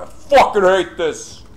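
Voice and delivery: high, loud burst of hate at the game; real exasperated shout; frustrated yelling. Real, not acted. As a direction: yell it out, fed up. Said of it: "Sorry for the yelling".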